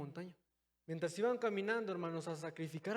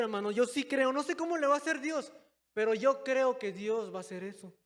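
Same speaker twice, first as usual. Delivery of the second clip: louder, higher voice of firm faith; confident Abraham; 5 semitones higher, 7 dB louder than usual. Retold or imitated. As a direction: declare your trust boldly.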